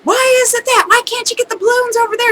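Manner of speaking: high-pitched mock indignant whine; imitated angry customer. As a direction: mimic an outraged customer demanding answers. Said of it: "she was so angry".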